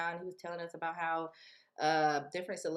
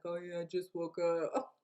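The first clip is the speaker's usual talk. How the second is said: slow, groggy excuse; sleepy fake voice. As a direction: pretend you just woke up. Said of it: "He was like".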